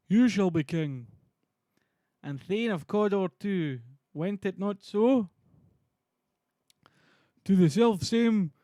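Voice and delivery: loud, slow, declaimed stage voice; theatrical Shakespearean dialogue. Acted. Streamer acting out Macbeth and Banquo's exchange, loud and declamatory. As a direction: proclaim each phrase grandly.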